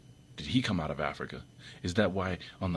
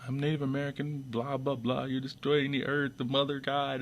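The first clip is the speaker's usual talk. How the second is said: mocking the Sicilian; 8 semitones higher than usual. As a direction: lightly parody his preachy claim. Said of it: "the guy that went around saying".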